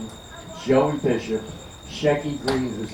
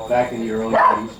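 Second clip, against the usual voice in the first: ordinary talk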